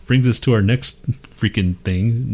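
ordinary talk

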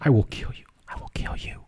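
mock-menacing whisper. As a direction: whisper a joke threat, creepily. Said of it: "my whisper".

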